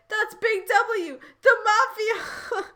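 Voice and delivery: high, slow, loud alarm; hammed-up cry to warn everyone; shouted. A narrator's reading. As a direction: shout it high, stretching the words. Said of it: "Paper shouted".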